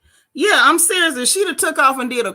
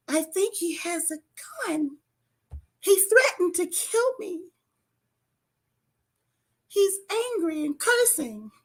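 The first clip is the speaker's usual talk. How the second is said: the 911 voice; 8 semitones higher than usual. Pretend. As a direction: play the helpless victim on the phone. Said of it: "switching to the voice".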